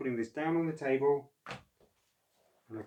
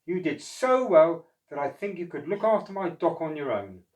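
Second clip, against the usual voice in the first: Mister O voice; 4 semitones higher, 7 dB louder than usual. Acted. Mister O proposal.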